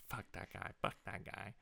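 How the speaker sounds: hushed mock dismissal; whispered. Retelling her dismissal of his friend, whispered. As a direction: say it softly under the breath. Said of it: "now she's saying".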